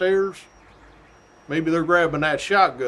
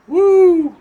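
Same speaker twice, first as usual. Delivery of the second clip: very high, loud whoop; excited whoop; 11 dB louder than usual. Real, not acted. Trooper recalling the scare to viewers, loud whoop. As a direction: let the leftover fright out.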